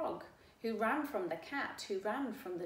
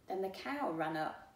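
plain reading voice

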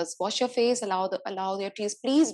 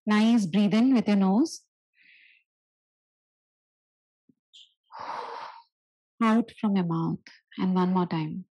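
guided breathing; 3 dB louder than usual. Acted. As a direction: lead them gently through breaths. Leads breathing exercise.

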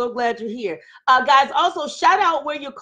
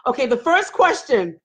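ordinary delivery

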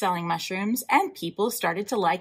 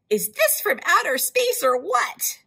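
high amazed customer; 10 semitones higher, 3 dB louder than usual. Acted.

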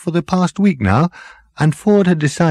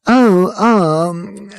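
higher, hesitant hairdresser; sheepish; 11 semitones higher, 4 dB louder than usual. A narrator's reading. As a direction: caught out, stalling. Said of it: "said the hairdresser with a sheepish look".

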